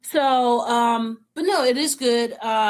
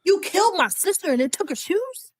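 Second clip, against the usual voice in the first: incredulous outrage; 9 semitones higher than usual. Acted. Fan ranting at the story in high-pitched disbelief. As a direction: demand an answer, getting higher with each question. Outraged rant about the witch.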